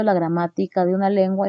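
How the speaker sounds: ordinary talk, nothing marked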